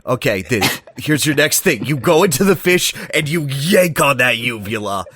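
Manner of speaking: lightly voiced gruff coach, laughing; Donkey Kong coaching. Donkey Kong giving Diddy his next lesson, mock coach voice. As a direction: bark out the training drill like a coach. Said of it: "next on Diddy's Hero Training".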